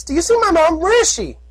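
high, pleading filly shout; young filly voice. Acted. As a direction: shout like a frantic little filly. Filly character voice.

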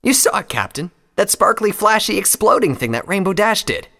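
high, loud and animated; eager young flier. Acted. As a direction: excitedly remind the captain.

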